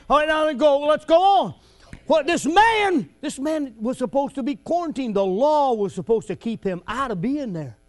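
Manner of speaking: ordinary talk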